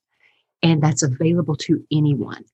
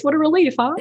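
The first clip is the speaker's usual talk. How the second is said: ordinary delivery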